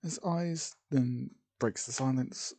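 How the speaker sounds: ordinary delivery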